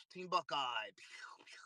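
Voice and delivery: whispered pew pew sound effect; playful whisper. Real, not acted. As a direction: breathe the gun noises. Playful whisper after win.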